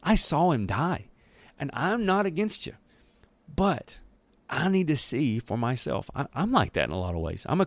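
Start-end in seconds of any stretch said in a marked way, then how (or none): 0.0-6.1 lightly voiced Thomas, higher and pleading; a hint of a doubting disciple, higher than his own voice; voicing doubting Thomas. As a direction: play Thomas asking gently for proof, pitched up. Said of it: "He's like".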